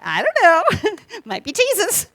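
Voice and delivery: high, loud, slow giggly answer; playful laugh. Retold or imitated. Answering fellow patients with a slow, giggly shrug. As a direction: toss it off with a laugh. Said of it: "And it's like".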